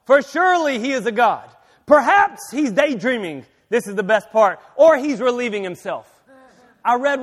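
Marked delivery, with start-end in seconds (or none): 0.0-3.5 loud, raised-pitch mock reasoning. Elijah taunting Baal's prophets about their god, mocking. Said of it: "Elijah began mocking them". 4.8-6.2 loud taunting jibe; mocking. Elijah taunting the prophets about absent Baal, mocking. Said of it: "Elijah began mocking them".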